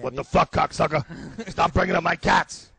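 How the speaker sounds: loud, affronted comic loudmouth; Joey Diaz. Acted. Impersonating the friend defending his cats, mock-affronted. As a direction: bristle in a brash mock tirade. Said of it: "Diaz complains about shit".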